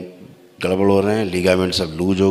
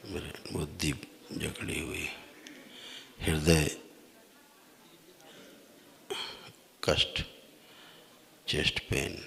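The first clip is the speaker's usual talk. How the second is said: hushed trance voice; 3 semitones lower, 21 dB quieter than usual. Acted. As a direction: murmur each ailment as if sensing it. Guru divining the visitor's ailments.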